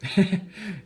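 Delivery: sudden loud, high burst of laughter. Real, not acted. Vlogger reading a friend's inside joke, loud high laugh.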